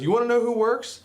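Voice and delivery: retold challenging retort. Mob boss challenging defiant workers, retold as a pointed retort. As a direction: imitate a boss's rising challenge.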